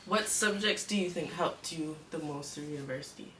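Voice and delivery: ordinary delivery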